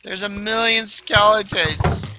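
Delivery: high, loud and drawn out; loud exclamation. Real, not acted. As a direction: cry it out slowly in alarm at the horde. Overrun, says line two ways.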